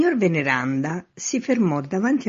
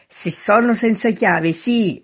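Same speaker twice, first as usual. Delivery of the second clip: high, loud reply; shouted answer; 7 semitones higher, 6 dB louder, slower than usual. A narrator's reading. Man at window answers man in street, shouting. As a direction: call the answer down.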